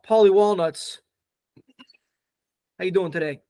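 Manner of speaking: a hint of a wiseguy agent introducing himself; lightly voiced New York Italian-American hustler; easygoing Italian-American New York accent. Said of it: "It is an Italian-American New York accent".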